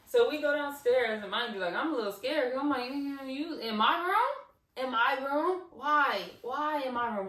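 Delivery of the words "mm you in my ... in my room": high, loud, drawn-out questioning; whiny, spooked disbelief; alarmed disbelief. Retold or imitated. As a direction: act out dismayed protest, stretching the questions. Acts her scared, dismayed reaction.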